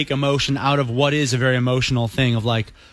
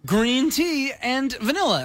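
much higher, slow ad pitch; ad announcer voice; 12 semitones higher, slower than usual. Retold or imitated. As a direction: read it like a bright ad. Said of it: "the email this summer that's just like".

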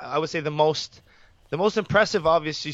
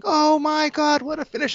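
much higher, mock yell of a stunned commentator; imitating excited yelling; 13 semitones higher than usual. Cohost imitating his partner yelling at a huge knockout, high mock yell. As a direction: echo the partner's shriek at a knockout. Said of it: "when he's yelling".

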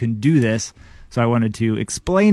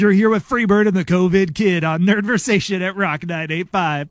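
radio DJ voice; 7 semitones higher than usual. Acted. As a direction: boom it out like a radio host. Radio DJ intro voice.

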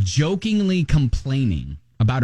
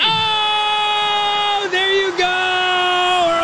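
excited shouting; slower than usual. Acted. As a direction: whoop it up like a crowd. Celebrating early spring.

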